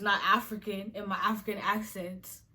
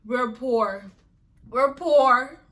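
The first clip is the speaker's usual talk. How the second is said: mock lament; 2 semitones higher, 8 dB louder, much slower than usual. Mocking stereotype.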